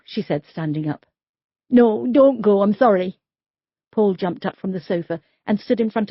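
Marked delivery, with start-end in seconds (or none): 1.7-3.2 loud sudden plea; pleading. Acted. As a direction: stop her leaving, desperate. Man begs officer to stay.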